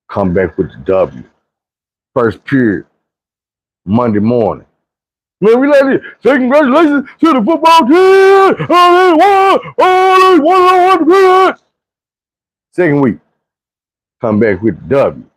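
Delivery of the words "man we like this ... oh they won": very high, slow, sing-song announcement; mock school announcer. Announcer congratulates the football team, very high and hammy. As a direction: ham up the morning announcement.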